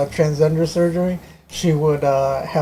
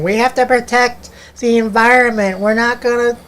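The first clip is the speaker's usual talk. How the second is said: pitched-up mocking imitation of her speech; 7 semitones higher than usual. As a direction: parody a preachy liberal, higher. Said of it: "I did hear her when she's on the West Coast".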